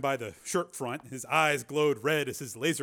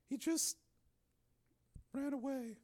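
fading, drained and much softer; hollow voice; 11 semitones higher, 10 dB quieter than usual. Acted. Donna repeating to Steele, hollow and fading. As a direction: let it die away, empty. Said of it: "she said in a hollow voice".

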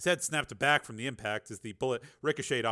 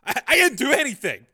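high, loud, stammered denial; rattled, raised-pitch protest; flustered protest; 12 semitones higher, 10 dB louder than usual. Acted. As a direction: protest your innocence, tripping on the first word. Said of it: "protested Franks".

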